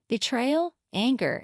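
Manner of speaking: plain reading voice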